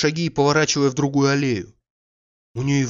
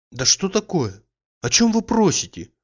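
rising, louder, agitated and a little annoyed; agitated, half vexed; 8 semitones higher, 4 dB louder than usual. A narrator's reading. Olga answers Oblomov, agitated and nearly vexed. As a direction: brush him off with flustered irritation. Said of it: "С волнением, почти с досадой".

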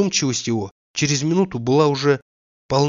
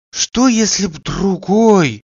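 horrified; 7 semitones higher, 5 dB louder, much slower than usual. A narrator's reading. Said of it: "с ужасом прибавил он".

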